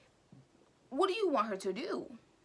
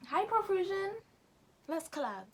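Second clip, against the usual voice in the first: playful pitch to brand; 10 semitones higher, 4 dB louder than usual. Real, not acted. Cheeky address to brand.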